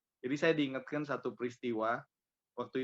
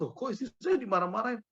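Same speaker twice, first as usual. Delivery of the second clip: indignant surprise; 9 semitones higher, 4 dB louder, faster than usual. Retold or imitated. Husband startled seeing wife scolded.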